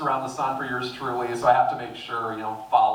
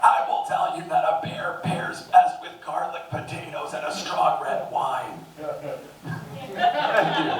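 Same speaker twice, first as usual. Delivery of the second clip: put-on strained, breathy raspy old politician; Robert F. Kennedy Junior; 5 semitones higher than usual. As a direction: rasp it out with a tight throat. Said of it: "Robert F. Kennedy Junior?".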